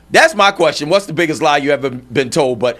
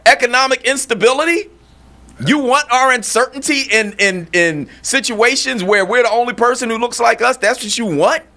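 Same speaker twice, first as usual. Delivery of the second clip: incredulous outrage; 10 semitones higher than usual. Real, not acted. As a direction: let the disbelief pour out, pitched way up. Incredulous rant at impostor.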